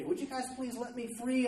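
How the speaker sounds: lightly acted high-pitched plea; mock pleading. Imagined plea the girl never made.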